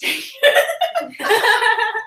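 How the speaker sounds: breaking up with laughter; laughing. Real, not acted. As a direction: giggle through the line. Breaking character mid scene.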